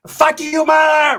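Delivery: very high, accented mock yell; mock paisa insult. Cook curses the customer, high mock yell.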